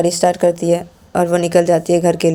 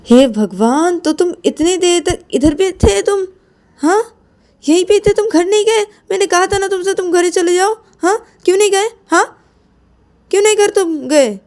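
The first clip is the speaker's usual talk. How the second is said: scolding shout; 12 semitones higher than usual. Acted. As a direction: berate her friend for waiting all day. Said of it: "तुम चिल्ला क्यों रहे हो?".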